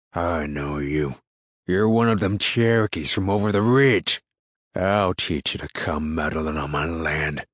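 rough country man, threatening a trespasser; bellowing man. A narrator's reading. Farmer threatens the boy he is chasing, bellowing. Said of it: "the man bellowed".

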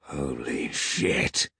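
low, very slow ominous mutter; dark mutter. A narrator's reading. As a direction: drag it out darkly under your breath. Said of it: "muttered Peg darkly".

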